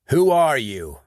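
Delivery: sharp, loud demand at gunpoint; demanding shout. Acted. Sean confronting the gunman in the mud, loud and demanding. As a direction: bark the question at the downed gunman.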